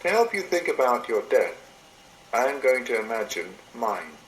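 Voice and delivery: normal talking voice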